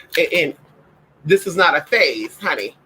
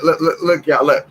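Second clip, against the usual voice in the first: normal talking voice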